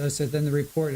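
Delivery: ordinary talk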